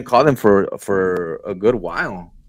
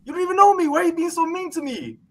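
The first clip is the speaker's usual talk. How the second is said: very high, whiny protest; climbing into a squeaky complaint; mock wounded pleading; faster than usual. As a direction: plead like you're hurt by the caller. Pleading with an angry customer.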